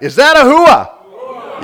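drawn-out, high, powerful shout. Shown as a demonstration. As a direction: roar the question slowly to fire up the crowd. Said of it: "little more power behind that".

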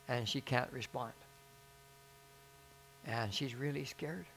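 subdued, halting plea; imitating tearful brothers. Brothers asking prayer for their mother, imitated subdued plea. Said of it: "and they're crying".

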